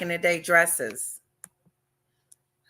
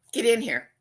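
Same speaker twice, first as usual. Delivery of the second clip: streamer greeting; 4 semitones higher than usual. Retold or imitated. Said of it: "One guy says".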